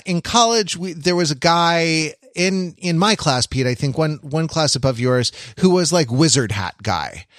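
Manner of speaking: usual speaking voice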